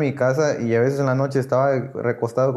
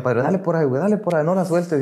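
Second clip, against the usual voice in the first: higher, insistent but not shouted coaxing; retold sponsor urging; 5 semitones higher than usual. Padrino telling him to keep venting, insistent urging. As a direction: lightly act out a mentor pushing someone on. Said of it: "Y me decía".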